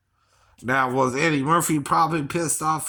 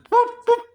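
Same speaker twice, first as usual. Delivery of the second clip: high beeps and wobbly mouth noises; loud, high-pitched vocal sound effects; imitated Spaceballs sound effects; 7 dB louder than usual. Shown as a demonstration. Showing off Spaceballs mouth noises, high electronic-style bleeps. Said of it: "this is Spaceballs".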